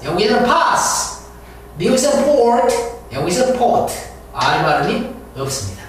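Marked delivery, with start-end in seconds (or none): none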